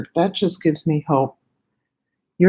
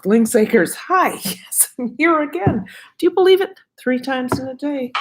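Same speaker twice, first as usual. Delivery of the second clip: excited greeting; 8 semitones higher than usual. Real, not acted. Greeting a returning viewer by name.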